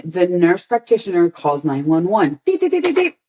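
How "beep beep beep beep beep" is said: high mock phone beeping; phone beeps. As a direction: beep like a dialing phone. Imitating a 911 call tone.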